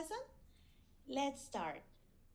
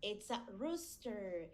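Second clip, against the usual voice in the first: ordinary talk, nothing marked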